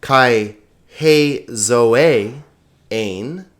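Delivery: slow, deliberate Greek reading with long pauses; careful, drawn-out recitation, clearly stressed syllables; measured read-along. Shown as a demonstration. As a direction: lead learners aloud word by word. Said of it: "sort of medium pace".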